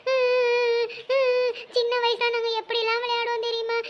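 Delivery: milk the sob story, sniffling between lines; fake weeping. Sister-act to soften the thief, hammed-up sobbing. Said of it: "பாக்கியா அக்காவும் நடிக்க ஆரம்பிச்சிட்டாங்க".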